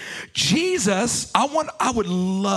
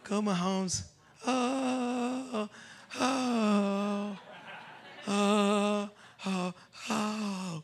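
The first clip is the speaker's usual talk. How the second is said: much softer fan cheer, held down; mock hushed cheering; 9 dB quieter, much slower than usual. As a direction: cheer the touchdown under your breath. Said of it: "You won't say it like that".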